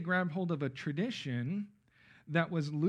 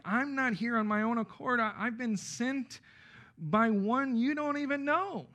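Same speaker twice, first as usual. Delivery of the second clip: higher, slowing ironic retort; pointed challenge in a raised voice; holy irony; 6 semitones higher than usual. Acted. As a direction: set them straight with pointed irony, drawing out the end. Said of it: "that seems to be the tone".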